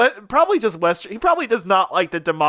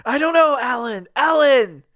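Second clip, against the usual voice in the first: higher-pitched stranded character calling down; stranded balloonist; 6 semitones higher than usual. Acted. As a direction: call out to Alan from up high, distressed. Acting Spider stuck on balloon.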